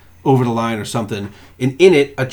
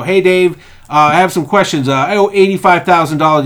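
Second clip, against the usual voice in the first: nervous caller; 5 semitones higher, 6 dB louder than usual. Retold or imitated. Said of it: "People call and go".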